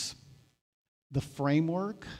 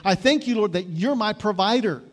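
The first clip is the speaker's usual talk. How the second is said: higher and louder grateful thanks, rising on thank; fervent thanks; 5 semitones higher, 6 dB louder than usual. Retold or imitated. Pastor showing the congregation thankful prayer, ringing and loud. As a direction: lift the thanks up with conviction. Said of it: "here, with thanksgiving".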